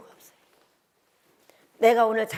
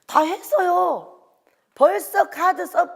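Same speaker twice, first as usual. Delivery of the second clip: slow, drawn-out son; 7 semitones higher, 4 dB louder, much slower than usual. Retold or imitated. Son quoted explaining.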